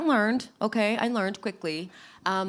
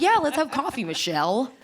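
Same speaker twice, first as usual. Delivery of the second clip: bright eager yes; 8 semitones higher, 3 dB louder than usual. Retold or imitated. Agreeing to meet the manager.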